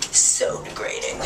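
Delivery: soft breathy whisper; hushed dramatic whisper. Acted. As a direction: whisper it for effect. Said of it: "How degrading?".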